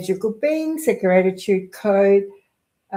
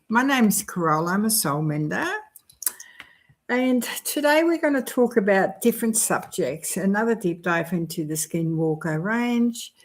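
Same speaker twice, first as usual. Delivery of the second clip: ordinary delivery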